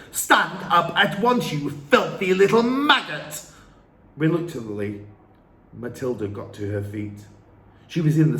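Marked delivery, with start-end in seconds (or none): 0.1-3.4 loud, high furious command; barked order. A narrator's reading. Trunchbull ordering Matilda to stand up. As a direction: snap the order out.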